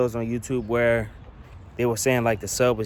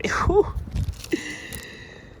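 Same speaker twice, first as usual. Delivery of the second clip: high giggle. Real, not acted. Laughs at the speaker inputs.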